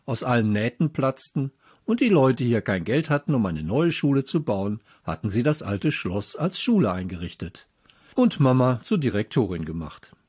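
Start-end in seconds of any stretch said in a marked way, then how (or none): none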